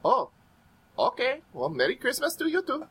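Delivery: lightly voiced polite cab driver; taxi driver Abdul. Cab driver answering a curt passenger, high character voice. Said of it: "He looks back at you".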